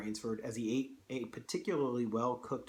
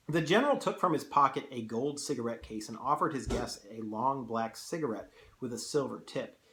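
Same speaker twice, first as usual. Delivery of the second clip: ordinary delivery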